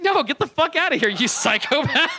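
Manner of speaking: high, loud laughing yell; mock outraged yell. Woman yelling at the comic who barged into her bathroom, high and loud. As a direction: throw him out, half laughing. Dominatrix throws him out.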